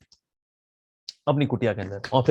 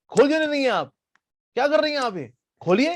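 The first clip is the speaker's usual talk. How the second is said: high urgent demand; 11 semitones higher, faster than usual. Acted. Jaimini demanding the girl open the door, pitched high. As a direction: press her, voice climbing high.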